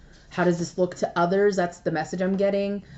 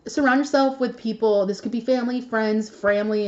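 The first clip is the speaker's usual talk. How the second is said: ordinary talk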